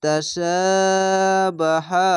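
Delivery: slow Quran chant, vowels stretched; tajweed recitation, slow and drawn. Shown as a demonstration. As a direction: stretch each long vowel out. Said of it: "এক আলিফ টেনে টেনে পড়বেন".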